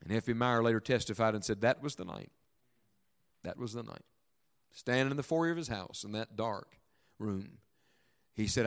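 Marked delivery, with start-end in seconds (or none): 3.4-4.0 very soft, reflective echo; softly repeated for weight; hushed repeat. Acted. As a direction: let it drop almost to nothing. Testimony line repeated, hushed.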